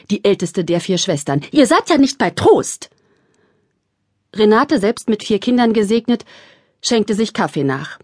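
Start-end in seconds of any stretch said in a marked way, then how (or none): 1.5-2.9 indignant. A narrator's reading. Thekla scolding sisters.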